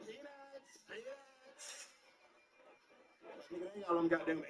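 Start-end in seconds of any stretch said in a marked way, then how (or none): 0.1-1.9 mock flight attendant call.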